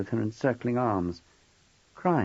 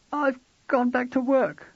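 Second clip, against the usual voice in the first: high, slow hesitant confession; wife owning up gently; wife's voice. Acted.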